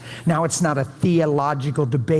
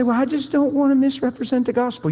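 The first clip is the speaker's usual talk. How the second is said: high-pitched mimicry of an objector; mock excuse; 10 semitones higher than usual. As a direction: imitate a reluctant person's excuse, pitched way up. Mimics people's excuse for not praying.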